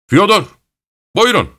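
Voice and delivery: high, carrying call. A narrator's reading. Master of the house summons Fyodor while carrying the frame, calling out. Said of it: "diye seslendi".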